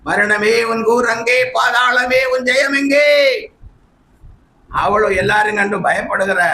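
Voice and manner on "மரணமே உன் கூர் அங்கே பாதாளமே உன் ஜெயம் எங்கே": raised, sustained preaching cry; preacher declaring victory; impassioned preaching. Acted. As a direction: proclaim it in a high, ringing chant-like line.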